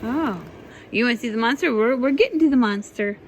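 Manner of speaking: plain reading voice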